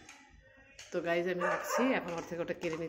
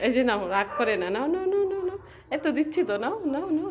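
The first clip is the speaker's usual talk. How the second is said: very high, loud laughing coax; laughing pet talk; 9 semitones higher, 7 dB louder than usual. Real, not acted. Owner laughing as she offers Koko the toy, very high pet voice. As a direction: giggle while coaxing the dog.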